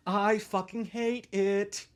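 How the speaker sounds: mock-mournful drawl; slow drawn-out lament. Shown as a demonstration. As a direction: drag the words out in misery.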